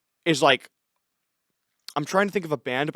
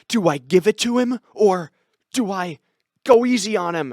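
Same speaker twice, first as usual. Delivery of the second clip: hammed-up aside, higher and louder; mock camera aside; 5 semitones higher, 6 dB louder than usual. Game heroine asks the camera what to do, hammed-up aside. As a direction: put the question to the camera, exaggerated and halting. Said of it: "looks at the camera and goes".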